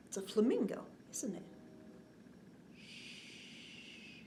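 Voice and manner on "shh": a long gentle shh; soft shushing. Acted. Librarian hushing the children, soft shush. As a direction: hush the room softly. Hushing kids for sleeping animals.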